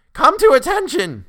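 very high, loud, slow cracking treble command; trying to thunder. A narrator's reading. Boy general orders the sentry, very high and loud. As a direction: bellow it but the boy's voice breaks high. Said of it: "he tried to thunder".

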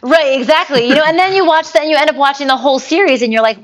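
loud, eager agreement; bursting with enthusiasm; excited. Real, not acted.

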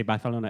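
Barcelona with a lisped c, bar-the-lona; Spain Spanish lisp. Shown as a demonstration. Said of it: "So they say".

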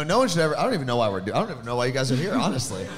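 normal talking voice

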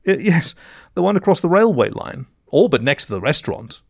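high, slightly stammering mole voice; helpful mole in blue. A narrator's reading.

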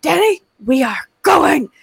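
put-on lightly ordering a child to leave; firm mom. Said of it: "I was like".

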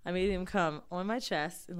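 usual speaking voice

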